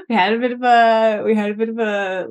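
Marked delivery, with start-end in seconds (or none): 0.1-1.2 words bubbling with laughter; giggly and a bit louder; laughing. Real, not acted. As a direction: push the line out through a laugh. Laughing while retelling family story.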